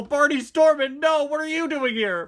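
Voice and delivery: high, loud panicked cry; a frantic high-pitched shout; Barney Stormin voice. Acted. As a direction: yell it in alarm as the plane comes in. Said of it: "You can't convince me Barney Stormin does not sound like that".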